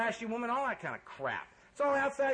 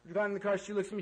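ordinary talk

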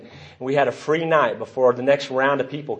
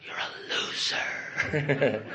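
hushed, drawn-out taunting whisper; sly, slow unvoiced-breath whisper; little voice in the head; 12 dB quieter, much slower than usual. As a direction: whisper it slowly like a nagging inner voice. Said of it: "this little voice in my head going".

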